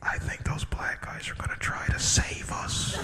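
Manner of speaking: hushed, unvoiced aside; whispering. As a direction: whisper it hopefully to the next passenger. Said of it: "they were like".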